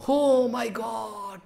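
slow, soft, choked lament; retold guru moved to tears; tearful cry. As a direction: sigh it out as if weeping. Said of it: "Prabhupada was crying".

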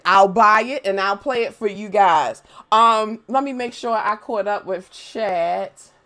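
ordinary delivery

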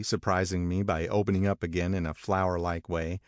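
ordinary delivery

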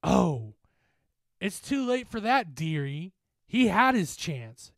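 high, loud, gloating lilt; mocking specter. Specter closing in on the group, mocking. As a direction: taunt her with false sympathy. Specter mocks the girl's plea.

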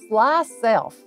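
plain reading voice

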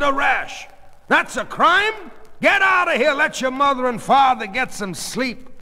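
high, loud retort; angry defensiveness. Acted. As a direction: snap back at full voice. Father yells at daughter.

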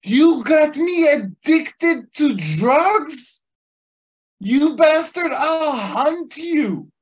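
very high, loud, slow furious threat; screaming rage. Retold or imitated. Cop screaming threats at his doctor, slow high rage. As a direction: scream it, drawn out and murderous. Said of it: "he screamed at him even further".

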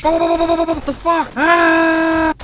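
high, loud, slow stretched what the fuck; yelling in shock at the game; shocked shout. Real, not acted. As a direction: blurt it out in real disbelief.

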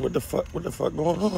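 high, panicked confusion; scared and disoriented; alarmed. Retold or imitated. Woken by a raid.

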